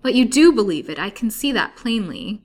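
loud, high anguished outcry. A narrator's reading. Patient disbelieved by her nurse, anguished. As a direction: cry out in anguish at being disbelieved. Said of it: "cried Lily in an anguished tone".

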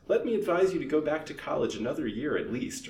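narrator's mildly insistent plea; urging. A narrator's reading. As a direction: press the advice on the listener. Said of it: "urged Missus Vance".

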